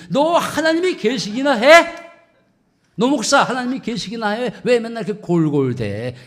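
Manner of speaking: loud jeer, high at the start; mocking challenge. Scoffer asking a sickly pastor if God exists, mocking. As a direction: shout it as a scornful accusation. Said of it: "조롱하고 비아냥거릴 수가 있다는".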